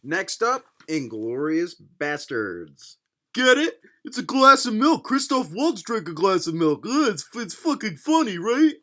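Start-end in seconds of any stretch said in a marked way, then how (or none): 3.3-8.7 loud, high, put-on eagerness; mock joke-explaining. Explaining the glass of milk photo, mock-eager voice. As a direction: nudge the listener to get the gag.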